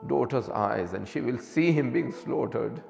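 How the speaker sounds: high, keening lament over music; mourning sermon recitation. Acted. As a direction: chant the tragedy as a grieving preacher.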